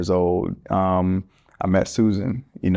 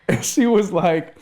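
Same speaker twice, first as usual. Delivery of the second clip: ordinary talk, nothing marked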